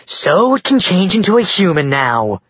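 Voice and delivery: sneering boredom at her husband; bored sarcasm. A narrator's reading. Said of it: "in a bored, sarcastic tone".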